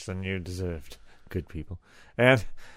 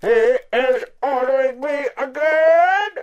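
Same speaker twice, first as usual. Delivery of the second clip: whiny little brother; 11 dB louder than usual. Pretend. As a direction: whine like a bossed-around kid brother. Whining about bossy elder brother.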